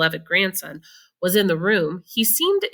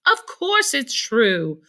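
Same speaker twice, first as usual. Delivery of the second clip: put-on old folks answering; 5 semitones higher, much slower than usual. A narrator's reading. Grandparents answer Charlie.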